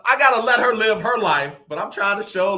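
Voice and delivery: normal talking voice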